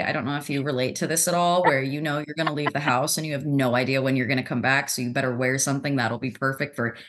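usual speaking voice

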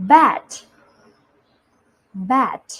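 "bat" as a careful, separate word; slow, clear model. Shown as a demonstration. Teacher models "bat" for children to repeat, slow and clear. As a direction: pronounce "bat" distinctly with a falling tune. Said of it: "อ่านตามพี่ช้าๆช้าๆชัดๆ".

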